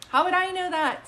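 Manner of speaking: high, loud burst of amazement; amazed exclamation. Real, not acted. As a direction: blurt it out, stunned at yourself. Medium amazed at own hit.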